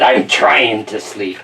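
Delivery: put-on slow, low pirate-accent grumble; grumpy pirate accent, falling away. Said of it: "so he has this accent".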